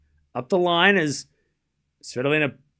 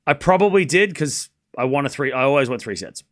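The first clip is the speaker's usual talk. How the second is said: usual speaking voice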